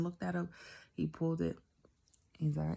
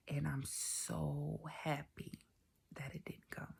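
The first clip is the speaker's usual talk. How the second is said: soft relieved hush; 10 dB quieter, slower than usual. Real, not acted.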